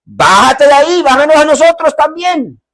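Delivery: high, loud jeering voice of the mocking thief; mocking taunt. Thief taunting the Lord on the cross, jeering high. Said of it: "le dicen y se burlan de él".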